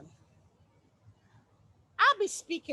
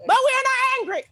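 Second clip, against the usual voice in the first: high, loud snapping denial; angry shout; 11 semitones higher, 11 dB louder, faster than usual. Shown as a demonstration. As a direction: yell the denial so it betrays you. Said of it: "Let me change my tone".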